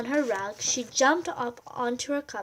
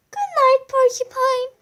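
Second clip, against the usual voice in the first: tender good night; 12 semitones higher, 8 dB louder than usual. A narrator's reading. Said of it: "and said".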